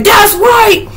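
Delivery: high-pitched crowing; triumphant mock yell. Retelling a man who chased off the animal, high triumphant yell. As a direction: imitate a victor calling after the enemy.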